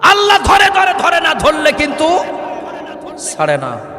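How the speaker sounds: very high, shouted sermon challenge; full-force preaching. Real, not acted. Preacher pressing the congregation for agreement, full-force preaching. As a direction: demand the crowd's answer at the top of your voice.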